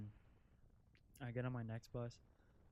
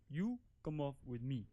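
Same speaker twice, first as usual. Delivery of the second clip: stranger's voice; 2 semitones higher, 6 dB louder than usual. Retold or imitated.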